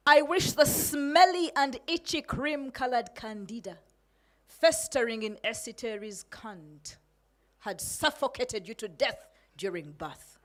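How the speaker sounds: defiant spoken-word delivery, hitting key words hard; protest poem performance. Acted. Poet addressing the president in a protest poem, defiant. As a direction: perform it as a rebel's angry poem.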